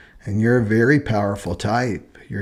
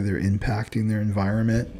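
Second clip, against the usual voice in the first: ordinary talk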